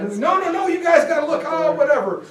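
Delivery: a frustrated plea that gives up; exasperated protest. Retold or imitated.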